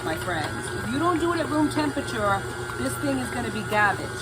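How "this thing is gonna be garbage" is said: usual speaking voice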